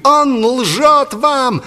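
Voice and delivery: high, loud, drawn-out accusation; barked accusation. A narrator's reading. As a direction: bellow it to the crowd in falsetto. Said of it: "гаркнул Игнат".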